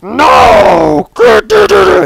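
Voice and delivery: loud, high yell of despair; retold shout. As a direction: yell at the crashed screen. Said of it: "and I was like".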